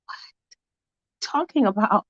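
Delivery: hushed, breathless disbelief; whisper. Acted. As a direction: whisper it in shock. Friend reacts, whispering.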